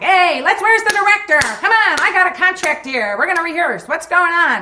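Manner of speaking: loud, high lounge-singer bluster; Tony Clifton bluster. Retold or imitated. Tony Clifton storming in with hookers, loud high bluster. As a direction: barge in like an obnoxious star demanding the director. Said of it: "going like".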